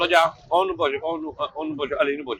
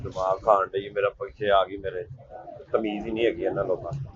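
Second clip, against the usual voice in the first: grumbling aside; 11 semitones lower, 7 dB quieter than usual. Acted. Muttered aside about the woman.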